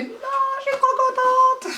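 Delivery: very high-pitched excited squeal, retold; overjoyed squeal. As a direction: re-enact squealing with delight at a lucky purchase. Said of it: "Vous voyez quand même dans quel état je me mets". (cri de joie suraigu, rejoué)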